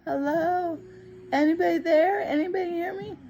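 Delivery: high, pleading calls for help, close to tears; retold desperate cries of hello; calling for help. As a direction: call out from the ground, hoping anyone hears. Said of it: "called and called".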